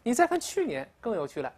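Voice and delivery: ordinary delivery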